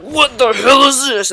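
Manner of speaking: mock alarmed "what the hell is this"; startled outburst. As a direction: imitate a man nearly hit by falling luggage. Luggage nearly hit his head.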